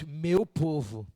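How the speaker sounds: slow, low, measured reply; unhurried divine response; God answering. Acted. God's answer, acted after the cry.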